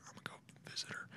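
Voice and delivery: very soft, voiceless whisper; conspiratorial whisper. Pretend. Plotting a visit to a celebrity, secretive whisper. Playful aside about visiting her.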